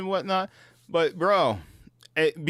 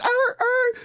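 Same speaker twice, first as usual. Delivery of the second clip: engine rev imitation; 3 dB louder than usual.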